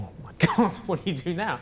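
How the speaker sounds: reliving the moment of panic, half laughing; shocked panic. Retold or imitated. Recalling panic as a room bursts into tears, high laughing alarm. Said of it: "And I remember kind of going".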